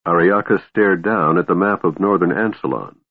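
plain reading voice

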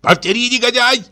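very high, strained angry demand; enraged gander. A narrator's reading. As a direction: squawk it like a teased gander. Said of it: "как раздразнённый гусак".

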